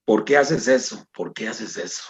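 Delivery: lightly voiced divine question, sinking low and soft; a mysterious voice, fading away; voice in the darkness. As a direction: repeat it slowly, dropping to a hushed low reproach. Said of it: "escuché una voz dentro de la oscuridad que me decía".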